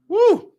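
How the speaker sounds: short, high "woo"; quick high whoop of pretend amazement; mock-impressed whoop. Sarcastic reaction to police freaking out.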